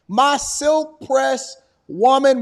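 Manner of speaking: slow, drawn-out words, imitating her emphasis; emphatic mimicry. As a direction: savour each word like a slogan. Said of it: "put some emphasis on it".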